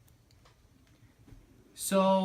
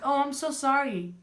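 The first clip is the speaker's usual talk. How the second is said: imitated apologetic technician; 10 semitones higher, 3 dB louder than usual. Technician apologizing again, retold.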